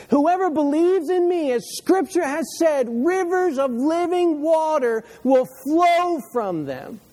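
loud, high proclamation, stretching out the final words; shouting to everybody. Acted. Preacher voicing Jesus shouting to everybody at the festival, loud proclamation. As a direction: cry the promise out over a crowd. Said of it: "he shouts this to everybody".

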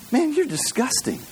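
high-pitched retold scolding; mock disgust. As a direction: scold him in lightly acted disbelief. Acted guard scolding upset stockbroker.

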